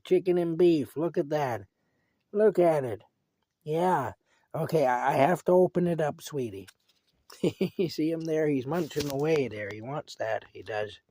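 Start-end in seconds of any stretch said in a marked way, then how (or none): none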